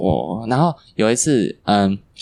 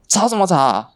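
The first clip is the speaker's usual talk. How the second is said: higher, scolding teacher imitation; mild mock scolding; mock angry teacher; 9 semitones higher than usual. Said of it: "我们老师就说".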